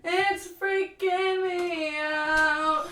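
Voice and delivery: drawn-out loud wail. Acted. Streamer to chat about her uncomfortable bra, drawn-out mock wail. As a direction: stretch every word out in a big mock wail. Repeats line, stretched and loud.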